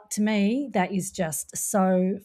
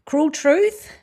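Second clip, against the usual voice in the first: Cruel Truth sung out high and loud, rising at the end; Truth lifted on a rise; showy title announcement; 8 semitones higher, 7 dB louder than usual. Shown as a demonstration. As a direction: present "Cruel Truth" like a grand reveal. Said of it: "the title says it all".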